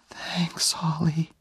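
high, faint whisper; last whispered words. Acted. Dying Jenny thanks Oliver, faint whisper. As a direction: fade out on a breath.

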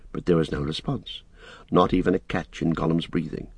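usual speaking voice

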